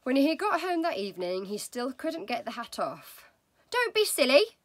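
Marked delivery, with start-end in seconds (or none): 3.7-4.6 high, loud dismissive wife; wife's voice. Acted. Wife scolding husband about stuck hat, loud and high. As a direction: brush off his fuss, much higher and louder. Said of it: "your wife said".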